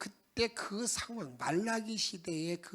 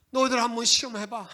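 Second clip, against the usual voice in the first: louder challenge; 3 semitones higher, 9 dB louder than usual. Acted. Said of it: "이렇게 말하고 있다".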